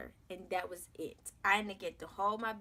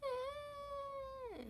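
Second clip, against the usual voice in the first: tiny, very high mewing "eh"; kitten-like cry; 8 dB quieter than usual. Retold or imitated. Mother imitating her newborn's first cry, a high kitten mew. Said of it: "kitten meowing".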